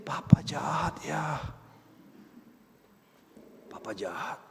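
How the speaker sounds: put-on soft slow child's whisper. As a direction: whisper it close in the ear, hurt. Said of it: "dia bisikin".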